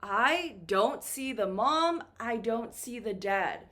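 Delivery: ordinary delivery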